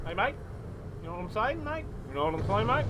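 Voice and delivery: a mock Cockney-Australian accent, lightly put on; Cockstralian accent. Shown as a demonstration. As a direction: play a cheeky Aussie bloke with a Cockney twang, saying mate. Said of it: "Australian accent".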